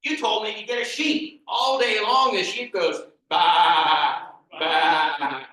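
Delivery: loud, high, furious rant with animal noises; angry rant. Acted. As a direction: storm at the wise man. Peter rants at the wise man.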